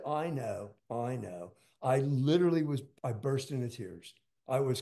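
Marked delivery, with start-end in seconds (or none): 0.0-1.5 low, soft imitation of a Liverpudlian; Liverpudlian accent. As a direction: gently echo a Liverpudlian murmur, dropping away. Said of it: "he whispered right in my ear".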